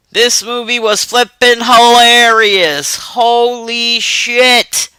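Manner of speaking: high and slow, drawn-out amazement; excited. Real, not acted. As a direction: stretch every word out in delight. Excited reaction to the movie.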